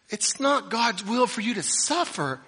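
hammed-up, loud, high mock of a prosperity preacher; mocking quote. Preacher mocking the claim to his congregation, loud high sneer. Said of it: "and then say".

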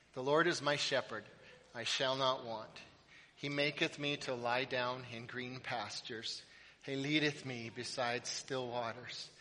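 reciting psalm. Acted. Pastor leading the church reciting Psalm twenty-three, softly. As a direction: recite it reverently, unhurried.

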